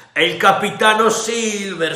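high, loud, falling announcement; rebel sailor voice. A narrator's reading. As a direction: present Silver with a flourish. The other rebel answers.

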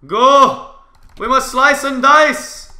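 high, loud yell into the fight; hammed-up war cry, high and loud; battle cry. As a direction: shout it like charging into battle.

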